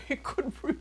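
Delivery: real laugh breaking through the words. Real, not acted. Laughing as she recalls kissing him in the car.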